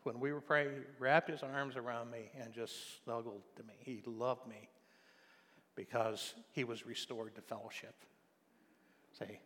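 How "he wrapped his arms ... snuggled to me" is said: ordinary talk, nothing marked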